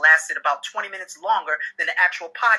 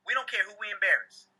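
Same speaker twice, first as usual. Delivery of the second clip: ordinary talk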